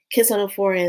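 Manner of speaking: ordinary talk, nothing marked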